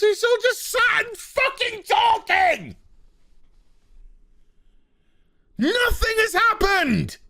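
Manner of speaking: high, yelling, fed-up outburst; furious rant. Real, not acted. Reviewer venting at the writers, shouting furiously. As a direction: scream your frustration at the show. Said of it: "I'm pissed".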